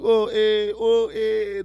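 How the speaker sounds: high, loud "Oh, eh" greeting grunt; comedian greeting grunt. Retold or imitated. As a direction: toss out a knowing "Oh, eh". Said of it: "that's how stand-up comedians talk to each other".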